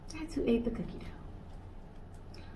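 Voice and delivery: soft, slow secret whisper. Acted. Secret told to kids.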